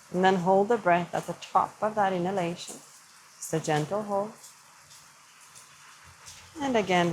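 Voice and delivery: calm yoga teacher easing the class into stillness; guided meditation. Acted. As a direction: lead them gently through the breath. Guides a seated breathing practice.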